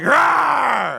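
high roar at the onlookers; mock bear roar. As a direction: roar like a kid playing bear. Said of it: "you were growling at everybody".

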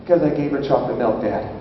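low, soft, matter-of-fact confession; deadpan child. Retold or imitated. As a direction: imitate a kid owning up without any fuss. Daughter innocently confesses.

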